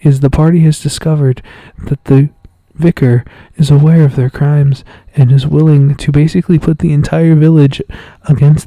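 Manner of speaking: loud, even-pitched recap voice; close-mic announcer. Pretend. Game master recapping last week's session, close-mic announcer. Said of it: "let me get real close to the mic and just talk like this".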